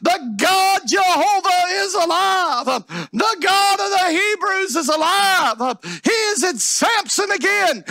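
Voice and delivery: high, shouted pulpit proclamation; crying out. Acted. Enemies realize Samson's God is alive, crying out. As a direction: holler it as alarmed news. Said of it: "The enemies begin to cry".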